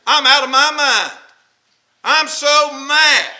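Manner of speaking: loud, high mock fury; acted rage. Voicing someone mad out of mind.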